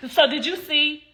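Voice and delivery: hammed-up imitation of the interviewee; mock fake sincerity. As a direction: mimic the staged interview line. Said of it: "and then it was like".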